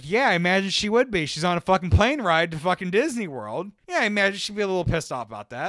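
loud, worked-up mock agreement; heated sarcasm. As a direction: retell it as an exasperated, rising rant. Retold telling-off of a friend.

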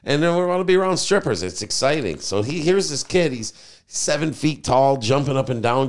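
ordinary talk

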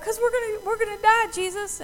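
high-pitched panicked protest; imitating frightened followers answering back; mock freak-out. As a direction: plead in hammed-up alarm. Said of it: "they're all freaking out".

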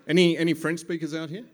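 usual speaking voice

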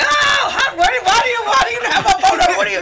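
high, loud, fast flustered shriek; hammed-up squeal of disbelief, tripping over words; starstruck freak-out. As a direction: act out losing it on the phone, stammering. Reenacting starstruck shock at the call.

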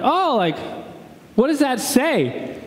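high, drawn-out polite question; feigned interest. Retold or imitated. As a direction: ask with put-on friendly curiosity. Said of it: "I'm like".

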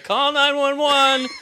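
high, slow, laughing panic; panicked call. Retold or imitated. Barista calling for help, hammed-up panic. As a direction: stretch each word in mock alarm. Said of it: "I'm immediately like".

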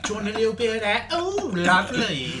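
hammed-up billionaire offering a treat; Richard Branson. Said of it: "Branson was like".

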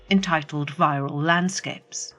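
usual speaking voice

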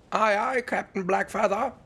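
high, loud pirate sidekick; eager lackey answering the captain; pirate crewman. Acted.

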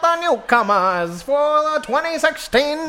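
announcer. Acted. Debate announcer.

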